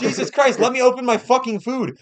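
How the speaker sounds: high, exasperated complaint; mock outrage. Customer wanting to open his own food, mock outrage. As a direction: plead indignantly, pitched well up. Retold outrage at being babied.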